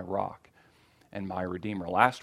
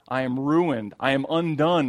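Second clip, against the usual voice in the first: higher and louder, a cry of ruin; anguished cry; 4 semitones higher, 5 dB louder than usual. Acted. Isaiah before the throne, crying out in anguish. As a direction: plead it out as if undone. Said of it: "I cried".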